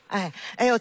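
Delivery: ordinary talk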